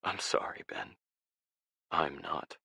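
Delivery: soft, whispered, breaking; tearful whisper. A narrator's reading. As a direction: apologize through tears. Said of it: "his voice tearful".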